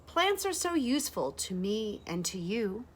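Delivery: ordinary delivery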